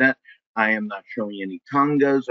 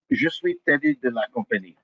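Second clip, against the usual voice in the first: put-on French accent. French persona introduces himself.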